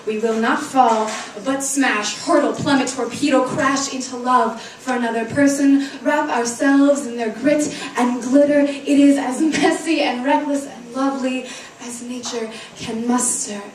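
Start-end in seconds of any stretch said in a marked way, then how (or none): none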